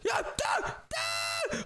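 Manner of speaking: high yell for dad; calling out. Real, not acted. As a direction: shout high across the room. Kid trapped, calling dad.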